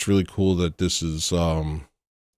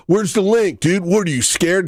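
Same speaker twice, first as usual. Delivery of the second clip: much higher, louder taunting bro voice; goofy high bro taunt; mocking annoying chatter; 10 semitones higher, 4 dB louder than usual. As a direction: mimic the obnoxious viewer, sneering. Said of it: "that's your freaking introduction to me".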